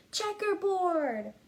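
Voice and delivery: put-on kid shouting. Classmate shouting a pattern at Camilla, slow playful shout. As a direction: stretch the shout like a teasing kid. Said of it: "Someone else shouted".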